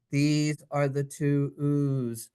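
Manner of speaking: normal talking voice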